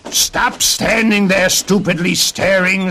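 high, loud sputtering little man; indignant storybook dwarf; angry sputtering. A narrator's reading. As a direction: spit out the words in a high-pitched temper. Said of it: "sputtered the little man".

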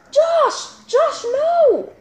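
high alarmed cry of a name; alarmed calling out. Acted. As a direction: call him back in a panic.

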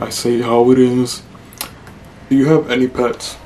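ordinary delivery

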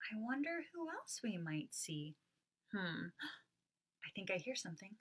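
soft, curious wondering; hushed suspense. Acted. Teacher softly building suspense for the next animal. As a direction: draw them in quietly.

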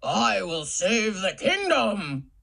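slow, noble hero voice; proud knight declaring his quest. Shown as a demonstration. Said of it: "say it in a voice to match who's talking".

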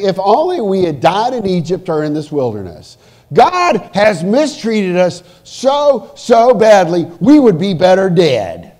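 loud, heavily stressed griping; angry complaint. Acted. Preacher voicing Israelites complaining in the wilderness, acted anger. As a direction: build from grumbling to loud, bitter outrage. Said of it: "really mad".

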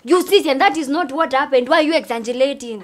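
loud, indignant protest; forceful, exasperated challenge; heated objection. Acted. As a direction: jump in loudly to set the record straight. Disputing an exaggerated account.

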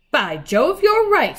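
high, loud excitement; astonished agreement. A narrator's reading. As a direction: exclaim as it dawns on you. Peter sees that she is right.

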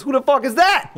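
high-pitched imitation of an affronted woman; hammed-up confrontational outburst; mock indignant. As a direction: fire it back, acting out offense. Acts out girlfriend turning on them.

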